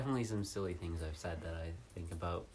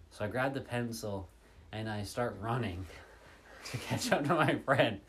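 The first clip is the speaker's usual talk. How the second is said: usual speaking voice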